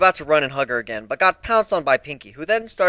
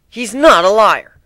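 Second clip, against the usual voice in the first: firm loud denial, stress on not; angry; 8 semitones higher, 11 dB louder, slower than usual. A narrator's reading. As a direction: insist hard, leaning on not. Nadine defends angrily.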